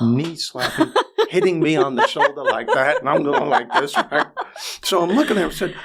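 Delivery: laughing while telling. Real, not acted. Telling the host about the man's laughing fit, laughing too. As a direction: laugh along as you describe him cracking up. Retelling man laughing hard.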